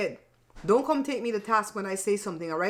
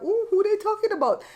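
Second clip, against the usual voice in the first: much higher, nosy wondering; curious ooh; 12 semitones higher than usual. Retold or imitated. Scrolling Instagram, much higher nosy wonder. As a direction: squeal it with gossip curiosity. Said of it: "I feel like".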